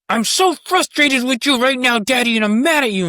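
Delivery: high, loud mock whine of a cross kid; upset child voice. A child scolding daddy, high and loud whining. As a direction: play a frustrated little kid scolding dad. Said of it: "It's like".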